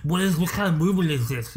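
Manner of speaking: watch nerd voice. Retold or imitated. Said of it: "I love that voice".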